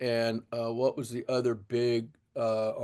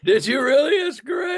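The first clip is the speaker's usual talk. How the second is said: much higher, shouted in joy; a happy shout that cracks up high; delighted; 14 semitones higher, 5 dB louder than usual. Real, not acted. Hearing the host owned the same Sears kit, a real delighted shout.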